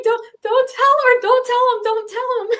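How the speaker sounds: high-pitched mock-panicked plea; freaking out. Caller silently begging the officer to keep her secret, mock panic. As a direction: plead frantically in a squeaky high voice. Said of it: "freaking out".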